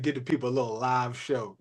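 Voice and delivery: just report his words, low and soft; quoting Keith. Retold or imitated. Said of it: "He said".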